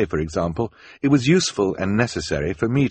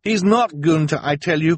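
high, raised-voice protest; insistent cry; 10 semitones higher, 5 dB louder than usual. Acted. Klaus insists to the adults, crying out. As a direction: cry out, desperate to be believed. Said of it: "Klaus cried".

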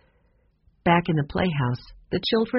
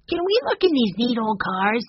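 put-on high-pitched child's question; eager little boy; 6 semitones higher than usual. A narrator's reading. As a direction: ask like an excited small boy. Young Benny asking eagerly.

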